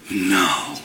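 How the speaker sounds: low, drawn-out mock shock; scandalized disbelief. Listener hearing juicy gossip, low scandalized disbelief. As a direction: drop the voice low in feigned horror.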